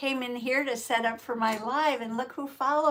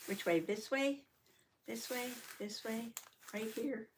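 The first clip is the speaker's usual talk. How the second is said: quiet checking; 9 dB quieter than usual. Real, not acted. Asking quietly while adjusting camera.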